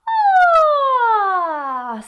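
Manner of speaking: long high "ah" gliding down; loud, high sigh sliding toward chest; head-voice sigh. Shown as a demonstration. Teacher showing how to bridge registers, sighing down. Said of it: "this is gonna help you bridge the gap".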